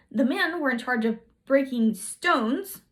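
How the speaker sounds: usual speaking voice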